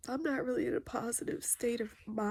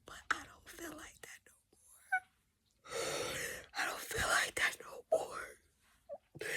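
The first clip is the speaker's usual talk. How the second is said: tearful whisper; 3 semitones higher, 5 dB quieter, slower than usual. Real, not acted. Grieving her mother's death.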